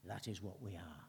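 a calm, inward reflection. Shown as a demonstration. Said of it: "Make it personal".

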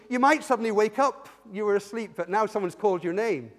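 normal talking voice

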